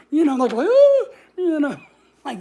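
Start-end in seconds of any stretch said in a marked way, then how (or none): 0.5-1.1 high imitated dog howl, loud. Dogs warning off the strangers, high mock howl. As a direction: yowl like a cranky little dog.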